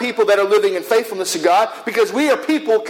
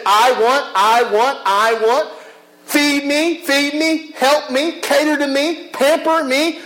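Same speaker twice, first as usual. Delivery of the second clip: a lightly voiced greedy inner voice, higher on the pleas; demanding flesh; 4 semitones higher, slower than usual. Preacher voices the flesh to congregation, mild greedy whine. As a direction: whine for more like a spoiled child. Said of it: "The flesh says".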